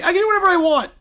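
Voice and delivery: high, loud bratty shout; bratty outburst. Acted. Princess yelling back at the critic, bratty. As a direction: defy them at the top of your voice.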